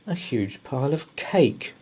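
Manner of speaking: plain reading voice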